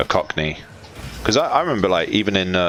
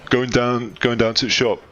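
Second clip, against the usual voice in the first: a lightly done Yorkshire going down to the shop, a bit higher; Yorkshire accent; 6 semitones higher than usual. Shown as a demonstration. Showing a friend the Yorkshire way of saying it, light Yorkshire accent. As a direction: play up the northern Yorkshire vowels. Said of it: "That's Yorkshire".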